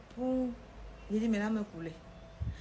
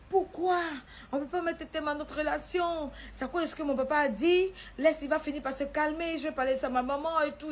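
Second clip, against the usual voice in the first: much higher and louder, urgent; pleading protest; 7 semitones higher, 4 dB louder than usual. Retold or imitated. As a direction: beg her not to end it. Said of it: "Mon gars me dit".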